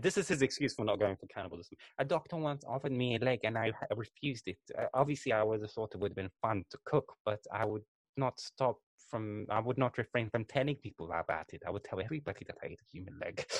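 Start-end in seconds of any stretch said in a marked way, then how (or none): 2.0-13.5 lightly voiced mumbling old man, much softer; a hint of a rambling old Englishman; old British taxidermist. Imitating the taxidermist on the documentary, soft and rambling. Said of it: "he's like".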